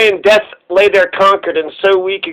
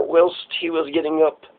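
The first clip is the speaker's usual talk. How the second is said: plain reading voice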